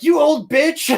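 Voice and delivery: high, loud imitation of a savage anime punk; sassy shout. Friend quoting Yusuke's savage dub lines, sassy mock shout. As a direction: belt out a mock insult. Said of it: "Yusuke is a sassy bitch".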